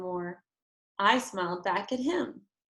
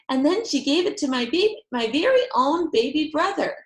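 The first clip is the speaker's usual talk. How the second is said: put-on high, loud chattering child; overjoyed; 6 semitones higher, 6 dB louder than usual. A narrator's reading. Girl dancing, telling parents, overjoyed. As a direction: rattle it off with delight.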